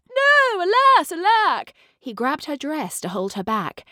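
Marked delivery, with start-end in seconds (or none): 0.1-1.7 very high, loud hammed-up despair; anguished cry. A narrator's reading. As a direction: wail as she flees. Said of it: "The prince cried".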